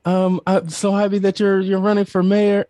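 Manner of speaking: proud mother, lightly mocked; excited mom. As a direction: imitate mom bursting with pride. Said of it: "she's like".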